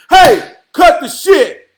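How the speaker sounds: loud, slow, angry snap at a busybody; slow hammered-out shout, every word punched; mock angry yell. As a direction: bark it out like you're telling someone to back off. Someone snapping at a nosy friend.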